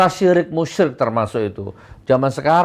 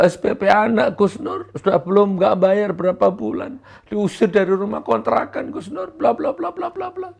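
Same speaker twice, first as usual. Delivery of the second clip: higher, pleading complaint; mock whiny complaint; 8 semitones higher than usual. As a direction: whine out their troubles. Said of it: "Curhatnya tuh loh". (jamaah curhat kepada Gus Nur, merengek)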